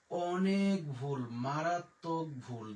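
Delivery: slow, weighty মারাত্মক ভুল; slow warning. Real, not acted. Teacher warning students about AI errors, slow and grave. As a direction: drag out অনেক ভুল and মারাত্মক ভুল slowly and heavily. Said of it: "warning about AI pronunciation".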